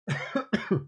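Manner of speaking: sudden choking cough; coughing fit. Real, not acted. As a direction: cough hard on a sip of tea. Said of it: "Excuse me, choking on my tea".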